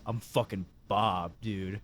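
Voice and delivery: mock stoned drawl. Stoned friend quoted.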